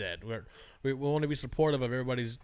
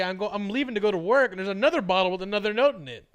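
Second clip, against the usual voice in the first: ordinary talk, nothing marked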